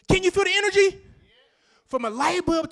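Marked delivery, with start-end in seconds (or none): 0.1-1.0 louder rousing question. Real, not acted. Hypes up the crowd, repeated.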